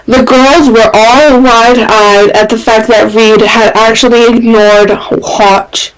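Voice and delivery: plain reading voice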